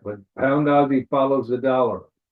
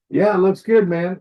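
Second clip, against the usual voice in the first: higher, louder mock enthusiasm; eager trader; 7 semitones higher, 6 dB louder than usual. Trader reacting to a promising chart, mock eager. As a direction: blurt it as an imagined upbeat trader.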